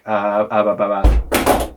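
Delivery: stammer, totally lost for words; mock speechless stammer. To his wife, stunned and stammering uhs. Speechless under wife's look.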